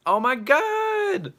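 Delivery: high, loud, drawn-out squeal; thrilled, stretched-out shriek; excited squeal. Real, not acted. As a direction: squeal it long and high in delight. Sudden excited greeting to Hillary.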